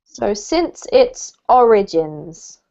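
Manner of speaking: slow model reading, ORigins stressed on the first syllable. Shown as a demonstration. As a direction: say "origins" slowly with the weight on "OR". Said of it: "It has the emphasis on the first syllable".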